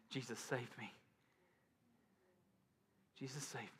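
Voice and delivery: soft to whisper; whispered plea. Retold or imitated. Preacher voicing Peter sinking, whispering for help. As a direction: beg in a fading whisper. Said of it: "we're all calling".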